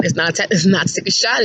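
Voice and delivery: normal talking voice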